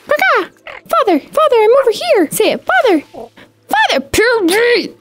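tiny chick voice calling its father; baby chick voice. Pretend. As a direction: play the baby bird crying for its father.

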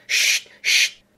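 loud, sharp voiceless shush. A narrator's reading. Hatter and Hare silencing Alice, shushing. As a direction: hush someone forcefully.

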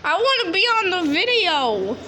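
high-pitched pleading pet voice; voicing the dog. Dog begging to be on video, high put-on voice. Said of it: "she's like".

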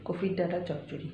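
speaking softly. Real, not acted. Hushed so Poko keeps sleeping, speaking softly. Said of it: "আমি আস্তে আস্তে কথা বলছি".

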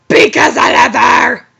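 loud passionate shout; shrieked declaration. Acted. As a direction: shout it out in love. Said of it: "Draco shrieked".

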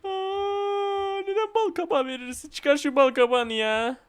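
high, whiny complaint; high whining protest at the pumpkin; squeaky whine. Real, not acted.

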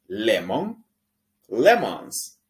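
"lemon, lemons" loud and clear, English sounds; model English pronunciation. Shown as a demonstration. Teacher modelling English words for students. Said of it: "Repita después de mí".

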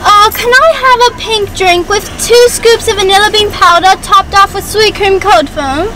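put-on British accent for ordering. Ordering a drink at the drive-thru, British accent. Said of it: "that accent when you order your Starbucks".

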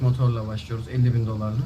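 usual speaking voice

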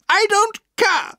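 high, slow, furious shout; defiant aunt yelling. A narrator's reading. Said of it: "she shouted".

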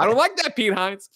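high mock guard voice, still amused; a jittery guard scolding Pete Hines; nervous guard. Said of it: "and the guy's like".

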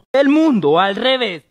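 slow low title announcement; announcer voice. Acted.

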